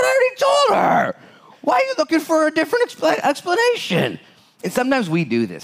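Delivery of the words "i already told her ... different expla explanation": high, shouting exasperation; exasperated angel. Pretend. Angel fed up with Manoah asking again, exasperated shout. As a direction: throw up your hands at him.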